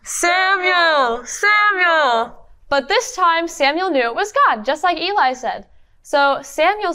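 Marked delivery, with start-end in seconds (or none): 0.0-2.5 lightly voiced God, drawn out. Shown as a demonstration. Storyteller leads the class in a mild God voice. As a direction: call it slowly like God. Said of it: "we're gonna say what God said".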